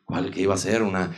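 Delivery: low, soft excuse-making; hushed, halting low voice; politician impression. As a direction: imitate a statesman explaining away a blunder. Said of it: "Y entonces dice eso".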